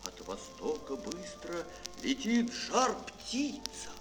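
plain reading voice

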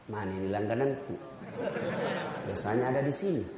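low, gentle, unhurried voice of the Prophet; calm Prophet. Acted. The Prophet gently answers the man who spat, low and calm. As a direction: smile through the words, easy and forgiving. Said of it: "Nabi bertanya-tanya".

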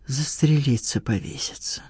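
dropping low into a near whisper; stunned murmur. A narrator's reading. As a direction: fade from soft voice to a breath, stunned. Stunned reaction to first love's return.

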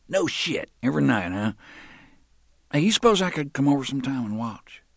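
leering thug. Acted. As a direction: lean in with creepy glee. Roland leering at McGuire.

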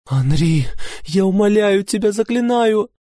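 high, tearful begging; desperate sobbing countess; sobbing plea. A narrator's reading. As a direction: beg through sobs, voice cracking high. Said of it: "Она рыдала".

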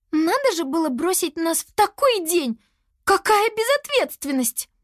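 high-pitched indignant wail; lamenting. A narrator's reading. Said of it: "Девочка причитала". (причитая, высоким голосом)

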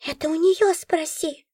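tattle on the cat; Nora's voice. A narrator's reading. Nora snitching on the cat, high creature voice.

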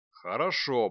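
loud, thundering reply; booming dragon. A narrator's reading. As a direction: let it rumble like thunder. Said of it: "прогремел дракон".

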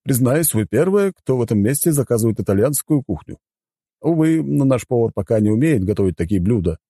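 high-pitched, polite butler; apologetic butler. Acted. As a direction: admit the kitchen's shortcoming with courtesy. (учтивый дворецкий с извинениями)

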